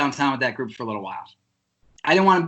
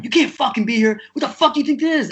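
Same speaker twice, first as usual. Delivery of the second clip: raised-pitch angry cop imitation; cop really going off; 8 semitones higher than usual. Cop telling drummer he can't be there, retold angry outburst. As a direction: act out a cop chewing people out. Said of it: "he's really going".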